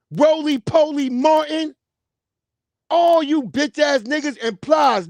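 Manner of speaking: shouting insults down a list of names; shouted roll call. Real, not acted. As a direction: call each name out loud and mocking.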